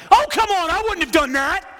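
loud, high indignant complaint; hammed-up armchair coach; mock fan outrage. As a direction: yell at the coach from the stands. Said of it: "you're like".

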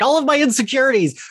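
high mock despair; mock dismay. Reacting to bad reviews, in high mock dismay. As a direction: squeal it like a wounded ego. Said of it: "I'm like".

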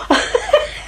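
high bright burst of laughter; delighted laugh. Real, not acted. Preacher laughing with joy at the vision.

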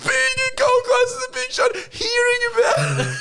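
crying with laughter at the big-shot line; laughing hysterically. Real, not acted. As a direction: barely get it out for laughing. Laughing fit retelling the joke.

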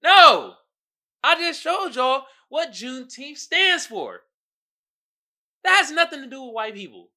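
loud, high outraged protest. Real, not acted. Creator yelling at the Juneteenth ad, loud and outraged. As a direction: shout down the ad. Angry rant at the ad.

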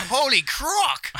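slow, high, loud Aussie exclamation; drawn-out excited croc hunter; Steve Irwin impression. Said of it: "deine Steve Irwin-Imitation".

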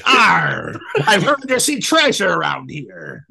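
put-on hammy old sailor growl, slow; pirate voice. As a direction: ham it up like a pirate captain. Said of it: "That was a great pirate face".

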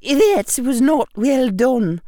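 high, slow Scottish professor, loud reproof; precise Scottish accent. A narrator's reading. Professor scolding her student, precise Scottish accent. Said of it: "in her precise Scottish accent".

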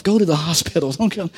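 ordinary delivery

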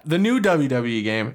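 ordinary talk, nothing marked

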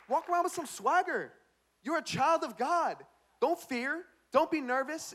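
high, punchy sermon exhortation; rally the congregation with punched phrases; rousing preaching. Real, not acted.

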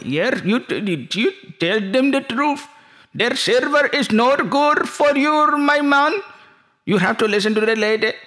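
put-on very high, slow, drawn-out Middle Eastern accent; Saudi friend. Saudi man offers gold and oil, high accented caricature. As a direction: play an eager Saudi salesman with stretched vowels.

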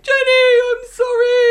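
high, loud hammed-up sob of sorry; mock wailing apology. Daisy wailing to Jenny, hammed-up sobbing apology. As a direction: overact the crocodile tears. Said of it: "And she's like".

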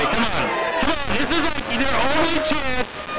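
high, loud urging; worked-up playful holler; rallying shout. Real, not acted. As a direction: goad the crowd into cheering. Rallying congregation for louder greeting.

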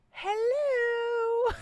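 high, drawn-out sing-song hello; a needy, hammed-up hello; mock sing-song hello. Texting an unresponsive friend again, high sing-song hello.

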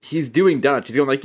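ordinary talk

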